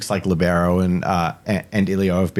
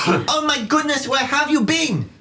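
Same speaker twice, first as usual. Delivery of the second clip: very high-pitched excited greeting; Ilio. Acted. Ilio greets the returning party, high and excited. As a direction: squeak it out in a frantic little character voice. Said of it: "to the sound of Ilio's commotion".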